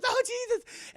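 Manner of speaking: very high frightened exclamation; mock panic. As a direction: imitate a woman grabbed by a dog. Voicing mother seized by dog.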